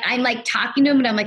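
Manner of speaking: ordinary talk, nothing marked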